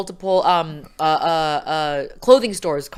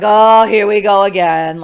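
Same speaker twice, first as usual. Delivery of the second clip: exasperated; 4 semitones higher, 11 dB louder than usual. Retold or imitated. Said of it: "And I'm like".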